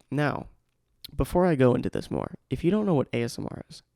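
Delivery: ordinary talk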